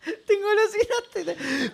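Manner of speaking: laughing through the words; teasing giggle, drawn out slowly. Real, not acted.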